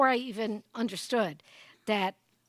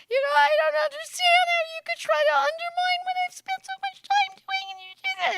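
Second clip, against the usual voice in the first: shrill high-pitched outrage; 5 dB louder than usual. Shown as a demonstration. Speaker demos a woman's too-high indignant reply, very high pitched. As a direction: voice shooting way up, much higher and louder. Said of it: "your voice goes up so high that only bats can hear you".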